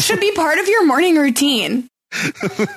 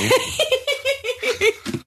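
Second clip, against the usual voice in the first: high airy laugh; 10 semitones higher, 5 dB quieter than usual. Real, not acted. Laughing at mate ship.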